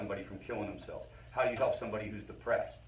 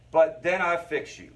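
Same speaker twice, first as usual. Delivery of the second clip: louder, slow, hammed-up therapist swagger; mock therapist boast; 5 semitones higher, 8 dB louder, slower than usual. Mocking therapists who fix what's wrong, loud smug boast.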